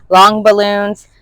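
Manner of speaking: usual speaking voice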